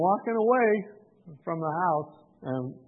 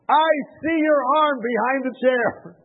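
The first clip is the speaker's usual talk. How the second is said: raised, drawn-out call through a door; 10 semitones higher, 7 dB louder, slower than usual. Retold or imitated. Visitor spots the man hiding behind his chair, calling out. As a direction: call slowly, high and loud, to someone hiding.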